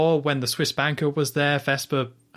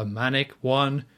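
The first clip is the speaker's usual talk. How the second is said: ordinary talk, nothing marked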